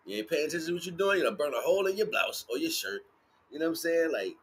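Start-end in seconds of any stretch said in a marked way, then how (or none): none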